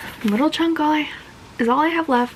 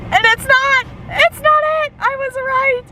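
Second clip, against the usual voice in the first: high and loud, triumphant; thrilled squeal; 13 semitones higher, 5 dB louder than usual. Real, not acted. Fan gloating to the camera, high excited squeal. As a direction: crow about being right. Thrilled her guess was right.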